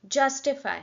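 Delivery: "justify" punched on "just", then falling away; stress on first syllable. Shown as a demonstration. Teacher demonstrating the air burst, "justify" stressed at the front. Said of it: "तो मैं just बोल रही हूँ, उसी में air".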